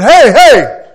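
loud, high call of hey; shouting. Retold or imitated. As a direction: yell to break it up. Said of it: "come running down the aisle shouting".

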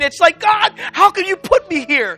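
driving, breathless pile-up of despair; impassioned preaching. Real, not acted. Preacher voices the world's despair to the congregation, impassioned. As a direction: pile up each phrase with mounting force.

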